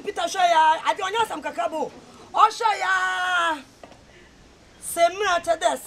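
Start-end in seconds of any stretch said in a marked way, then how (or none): 2.3-3.6 calling out. Real, not acted. Calling out to the gathering.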